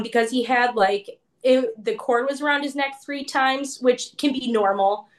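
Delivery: normal talking voice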